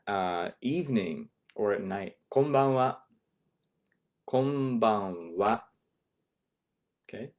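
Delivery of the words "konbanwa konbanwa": Konbanwa then slowly stretched; model greeting. Shown as a demonstration. Teacher models the evening greeting slowly. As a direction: drag out the syllables of Konbanwa. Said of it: "If we're greeting someone in the".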